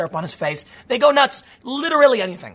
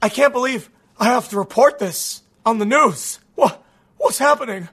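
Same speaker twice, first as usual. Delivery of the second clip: mocking outraged anchor; 4 semitones higher than usual. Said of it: "He, he, he says".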